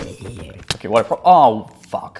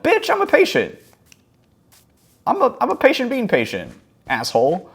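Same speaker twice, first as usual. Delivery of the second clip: higher, mock-outraged inner rant; indignant rant; 3 dB louder than usual. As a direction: vent at the rude old man, pitched way up.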